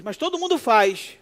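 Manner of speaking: put-on high, loud child's protest; whiny child excuse. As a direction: protest like a kid to mom. (desculpa de criança, alta e aguda)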